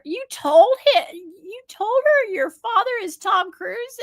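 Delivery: high, loud scolding disbelief, retold; upset woman, imitated. Minder scolding kids for telling a stranger, high upset outburst. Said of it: "And she was really upset".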